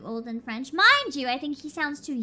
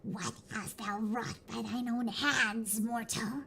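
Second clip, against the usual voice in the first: put-on baby dragon voice; 4 semitones lower, 7 dB quieter, slower than usual. Continues dragon voice.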